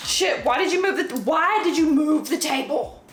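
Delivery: fed up, call out the culprit; exasperated. Real, not acted. Vlogger scolding someone who moved the table, exasperated.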